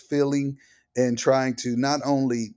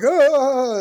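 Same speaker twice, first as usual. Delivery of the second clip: high, loud delighted exclamation; joyful burst; 14 semitones higher, 9 dB louder than usual. Retold or imitated. Father evoking his daughter's joyful spirit with a high, loud cry. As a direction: burst out with her joy.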